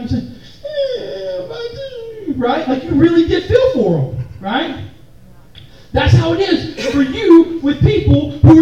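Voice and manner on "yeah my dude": mock tearful sympathy. Consoling a friend over goldfish.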